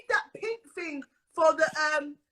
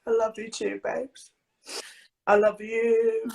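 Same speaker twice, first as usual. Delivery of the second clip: lower, tearful birthday thanks; choked-up crying; 7 semitones lower than usual. Real, not acted. Birthday girl thanking her best friend, crying. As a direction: push the love out through tears. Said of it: "Bloody crying, woman".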